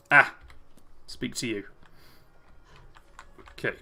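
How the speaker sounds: ordinary delivery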